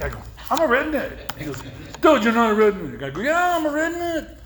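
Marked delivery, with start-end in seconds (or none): 0.5-1.4 high-pitched indignant protest; retold insistence. 2.0-3.0 louder, higher exasperated rebuttal; retold contradiction. Retold back-and-forth quarrel. 3.2-4.4 very high-pitched comic protest; retold insistence.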